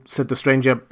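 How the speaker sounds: ordinary delivery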